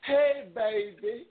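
put-on slow, high crooning hey baby; lightly voiced uncle sweet-talking Myrtle.

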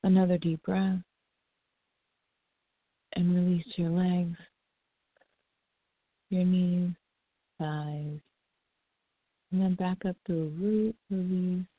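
calm them down breath by breath; soothing massage murmur. Acted. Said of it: "just a relaxing breathing".

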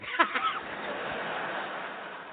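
breathless high-pitched squeal; airy high giggle; high squeal laugh. Acted. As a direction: squeal with glee. Giddy reaction to "come here.

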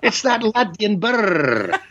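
slow, rolled-r Latvian burr for Sean Connery; mock Latvian brr. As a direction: drag out the Latvian brr mockingly. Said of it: "I said".

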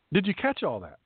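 ordinary talk, nothing marked

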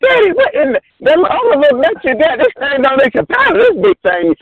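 high-pitched daughter imitation; imitated daughter. Dad retelling his daughter's amazed remark, high mimicking voice. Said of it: "My daughter, she said".

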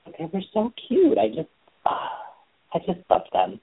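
high, breathless adoring gush. Real, not acted. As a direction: melt over how cute they were. Gushing about cute couple.

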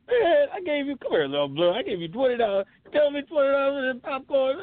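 high, put-on griping dad; dad joke voice. Dad complaining to his kid about money, high put-on voice. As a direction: grumble like a dad, pitched well up. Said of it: "vintage dad jokes".

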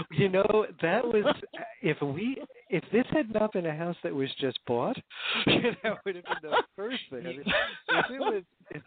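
talking through real laughter; laughing. Real, not acted. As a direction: laugh while you answer. Laughing about listeners yelling move.

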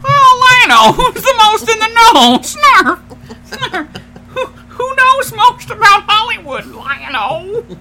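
very high, loud squeaky creature; snarf voice. Acted. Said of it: "I was gonna do my snarf voice".

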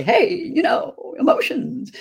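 high, slow mock-chirpy hello; mock cheery preaching. Guest mocking pushy cheerfulness to host, high and slow. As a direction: lightly parody a pushy positivity preacher. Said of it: "walk around and say".

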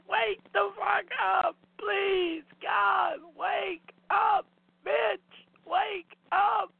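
frantic high shouting, near crying; crazed with grief. Real, not acted. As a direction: scream at the screen to wake. Screaming at the screen.

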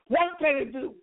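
rising, fervent preacher cadence; preaching. Acted. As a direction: build the preaching up. Preaching climb, higher pitch.

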